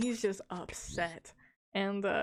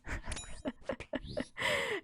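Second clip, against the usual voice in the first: a breathy laugh that jumps very high; 4 dB quieter than usual. Real, not acted. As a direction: burst out laughing, airy and high. Laughing at the story.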